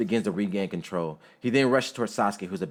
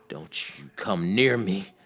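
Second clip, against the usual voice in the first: slow, strained warning, only mildly raised; restrained yell; slower than usual. A narrator's reading. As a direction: warn someone off slowly, holding the yell back. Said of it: "Gaara yells".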